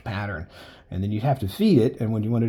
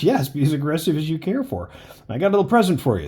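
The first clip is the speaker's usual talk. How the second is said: higher, louder and fast mock doctor; doctor offering a shot, higher, louder, quick; eager doctor; 5 semitones higher, 5 dB louder than usual. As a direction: rush it like a doctor with a needle ready. Doctor voiced in retold visit.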